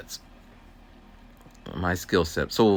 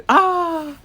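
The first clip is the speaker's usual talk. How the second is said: excited exclaim; 8 dB louder than usual. Retold or imitated. Excited groundhog description.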